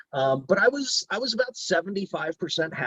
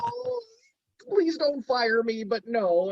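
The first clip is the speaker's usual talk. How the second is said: mock pleading; 12 semitones higher, slower than usual. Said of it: "retold plea".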